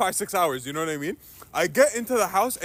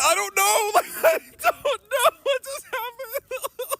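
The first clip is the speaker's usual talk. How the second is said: 1 nervous laughing; much slower than usual. Real, not acted.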